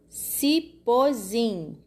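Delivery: "Cipozim" very slow, ci-po-zim; syllable by syllable. Shown as a demonstration. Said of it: "três sílabas".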